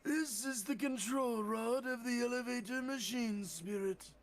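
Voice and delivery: high, strained and wheezing; wheezy voice. Acted. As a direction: wheeze out every word like an asthmatic. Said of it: "do a wheezy voice like you were doing earlier".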